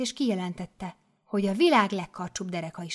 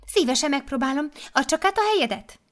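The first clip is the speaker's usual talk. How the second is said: higher, louder eager voice; eager mother superior; 7 semitones higher, 5 dB louder than usual. Acted. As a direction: rush to the instrument.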